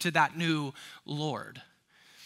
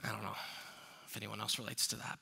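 much softer, lower, murmured; quiet aside; 8 semitones lower, 12 dB quieter, faster than usual. Real, not acted. Pastor admitting to the congregation, quiet aside. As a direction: drop to an aside.

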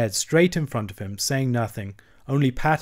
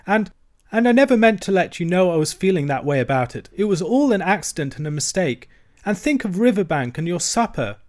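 higher, louder distressed protest; tearful pleading; 7 semitones higher, 5 dB louder than usual. A narrator's reading. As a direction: plead through tears to give up. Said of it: "cried the Mole".

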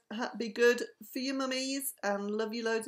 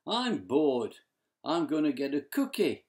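low bored voice; 6 semitones lower, 4 dB louder than usual. Acted.

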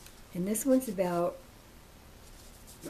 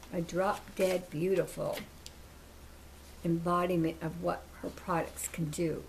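ordinary talk, nothing marked

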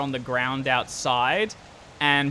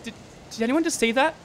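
high, stammered question rising to a loud warning; alarmed Clarissa; 11 semitones higher, 8 dB louder, faster than usual. Acted. Woman at bus window spots something outside, alarmed. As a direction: start startled, then call out the danger. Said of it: "She goes".

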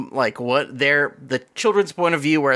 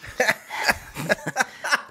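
breathy, high creepy cackle; evil cackle; 8 semitones higher, 4 dB quieter than usual. Retold or imitated. Voicing the monster lady mid-threat, creepy cackle. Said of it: "little monster lady".

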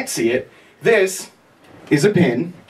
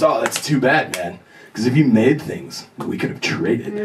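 lightly voiced laid-back hippie, lower; dopey hippie; 7 semitones lower than usual. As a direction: drop lower into a hint of a mellow hippie. Stoner hippie's dry reply.

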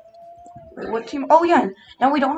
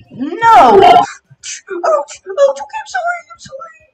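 high pained yelps; screaming while falling; 5 dB louder, slower than usual. Acted. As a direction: yelp and shriek as you drop. Getting pushed off, screaming.

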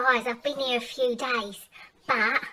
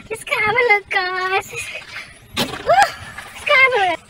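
higher, louder snooty Mad Mel complaining about the holiday; 11 semitones higher, 4 dB louder than usual. Acted. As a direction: play the stuck-up runaway sneering at the cheap holiday. Mad Mel character continues.

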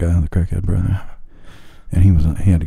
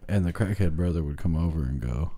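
normal talking voice

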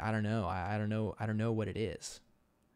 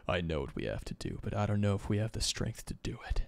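whispered grim resolve; softer whispered character line; hushed whisper; 3 dB quieter than usual. Acted. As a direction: whisper it like a doubting hero. Voicing a grim hero.

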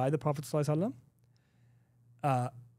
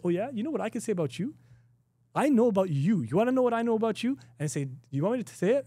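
taunting comeback; 6 semitones higher than usual. Retold or imitated. Acted comeback to an insulter.

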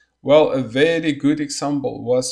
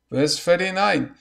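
ordinary talk

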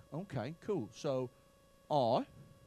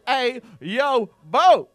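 loud, raised dancehall call-outs; mock hype shouts; 5 semitones higher, 14 dB louder, much slower than usual. Comic mimicking a club track, loud hype shouts. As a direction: punch out each shout like a hype man.

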